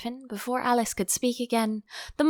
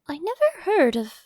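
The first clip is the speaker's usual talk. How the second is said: hesitant; 7 semitones higher, 3 dB louder than usual. Acted. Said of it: "Alice ventured to say".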